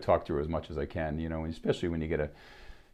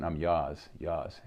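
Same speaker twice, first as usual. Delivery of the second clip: Boston accent with dropped R, "yours" as "yahz"; much slower than usual. Shown as a demonstration. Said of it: "as they say in Boston".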